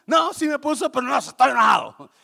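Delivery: loud mock-angry protest. Grouch answering his friend about lunch, angry mock shout. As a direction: shout it like a man cross for no reason. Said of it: "está enojado".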